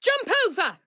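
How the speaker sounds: high, urgent command; urgent shout. Acted. Ally shouts at a partner to jump, urgently. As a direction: yell it mid-chase.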